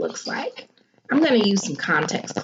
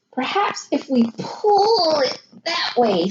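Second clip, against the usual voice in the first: higher, slow, thoughtful dad; Dad's voice; 7 semitones higher than usual. A narrator's reading. Dad proposing a fix to his son, slow and higher. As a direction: suggest it slowly, puzzling it out. Dad's line in read-aloud.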